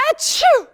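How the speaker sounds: loud high sneeze; big sneeze. Acted. Rhinos sneezing at the bugs: loud high sneeze. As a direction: let out a huge achoo.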